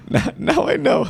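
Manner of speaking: laughing while speaking; high, slow, laughing through the words. Real, not acted. Host laughs at the guest's answer.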